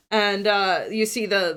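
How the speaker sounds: usual speaking voice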